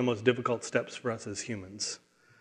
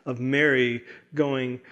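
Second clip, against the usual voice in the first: ordinary talk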